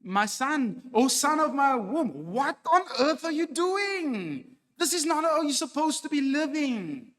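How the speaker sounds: a mother scolding her son in despair; mother rebuking her wayward son, high and loud; pleading mother. Retold or imitated. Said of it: "the mother instructs her son".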